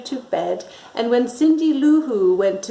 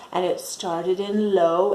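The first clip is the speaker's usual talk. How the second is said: ordinary delivery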